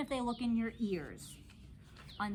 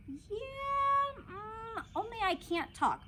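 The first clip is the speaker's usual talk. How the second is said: whimpering; 14 semitones higher, much slower than usual. A narrator's reading. Upset child refuses to tell.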